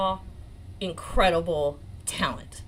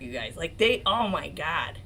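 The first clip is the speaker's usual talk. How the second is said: usual speaking voice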